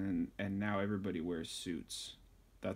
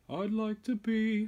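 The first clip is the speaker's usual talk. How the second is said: [name] voice; 13 semitones higher, 6 dB louder than usual. Retold or imitated. Voicing [name] wanting to sing.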